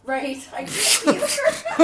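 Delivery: words through a big laugh. Real, not acted. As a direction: laugh while saying it. Laughing at the joke.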